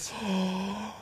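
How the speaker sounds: sharp quiet gasp; shocked gasp. Retold or imitated. Shocked reaction in story.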